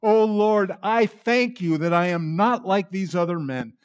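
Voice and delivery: self-righteous Pharisee praying aloud in the temple; proud praying Pharisee. Retold or imitated. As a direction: lift it to heaven smugly, then sneer at the tax collector. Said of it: "who prayed in the temple with his eyes and his hands lifted up".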